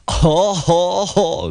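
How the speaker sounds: high loud spoken haw haw; mock truck guffaw. A narrator's reading. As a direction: guffaw rudely. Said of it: "guffawed the trucks".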